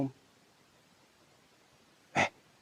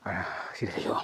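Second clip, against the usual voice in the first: whisper; 3 semitones lower, 6 dB quieter, slower than usual. Real, not acted. Ghost hunt, calling softly.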